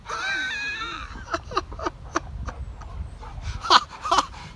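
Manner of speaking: long, high, shrieking burst of laughter; helpless screeching laugh; howling laugh. Real, not acted. Laughing at the book title.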